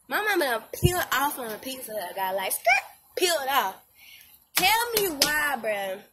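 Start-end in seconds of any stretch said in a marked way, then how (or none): 2.5-2.8 1 tire screech. Retold or imitated. Tire screech sound effect.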